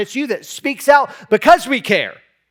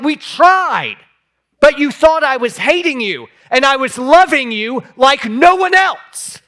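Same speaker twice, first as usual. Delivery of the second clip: retold pleading reply; 5 semitones higher, 5 dB louder than usual. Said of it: "I'm like".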